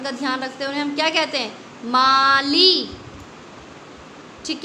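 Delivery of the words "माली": माली with long आ and ई drawn out; teacher reading माली aloud to the class; stretched reading. Shown as a demonstration. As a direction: say माली slowly, both vowels long. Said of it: "ऐसे ही पढ़ते हैं".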